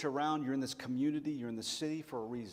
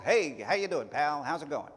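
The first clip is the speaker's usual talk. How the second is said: put-on old-movie guy talk; 6 dB louder than usual. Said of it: "I was meant to talk".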